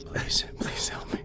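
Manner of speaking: hushed mock plea; whispering. Joking plea after failed roll.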